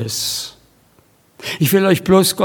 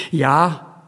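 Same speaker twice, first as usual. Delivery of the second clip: ordinary delivery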